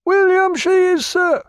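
high, loud call from the masthead; lookout hailing. Acted. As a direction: call down from aloft, unhurried. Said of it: "replied the lookout after a considering pause".

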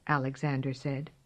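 ordinary delivery, nothing marked

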